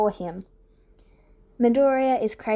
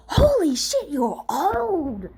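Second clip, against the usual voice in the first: shocked shout; 8 semitones higher, much slower than usual. A narrator's reading. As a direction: blurt it out in disbelief. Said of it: "Oboro shouts".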